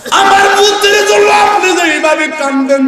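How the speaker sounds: high, loud chanted lament; impassioned cry. Retold or imitated. Mother answering the Prophet about her son, high impassioned cry. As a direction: voice the mother at full preaching pitch.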